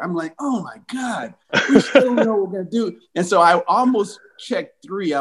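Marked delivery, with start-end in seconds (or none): 0.4-3.0 high-pitched, hammed-up alarm; mock dismay. Player heading back out without a call, mock dismay. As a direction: retell a player's panic for laughs. Player despairs after coach's non-answer.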